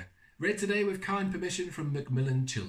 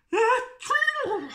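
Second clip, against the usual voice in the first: sneeze; 8 dB louder than usual. Acted. Mimics a sneeze.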